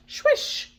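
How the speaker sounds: voiceless hushing swish. Acted. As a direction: whoosh the word like moving air. Sound effect in story.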